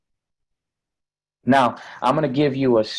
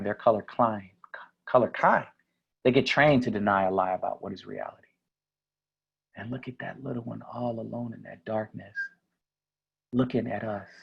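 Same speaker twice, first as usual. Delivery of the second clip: ordinary talk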